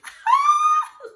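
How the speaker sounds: very high, loud shriek of laughter; loud burst of laughter. Real, not acted. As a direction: crack up at the memory. Laughs at own story.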